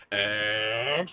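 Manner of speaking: slow, stretched name announcement; announcer callout. Acted. Streamer carefully announces the patron name Exaria, slow. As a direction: draw out the name like an MC.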